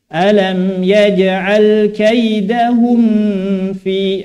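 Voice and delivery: slow recitation blurring the mim into "fi"; overdone ikhfa. Shown as a demonstration. Teacher demonstrating exaggerated ikhfa as an error. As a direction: let the M of "kaidahum" slide into the F. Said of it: "Ifa di sini bisa digunakan berlebihan".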